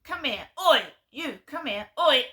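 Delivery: imitated bossy calls, loud on the oi; mock shout. Woman calling vlogger over, mock bossy shout. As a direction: order them over like a pet.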